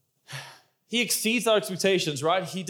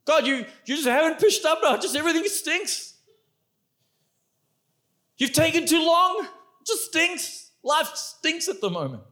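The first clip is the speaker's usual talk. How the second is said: higher, mildly exasperated complaint; heart complaining to God; 8 semitones higher than usual. Acted. A weary heart complaining to God, lightly exasperated. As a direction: whine that everything stinks. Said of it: "That's what Martha's heart was saying".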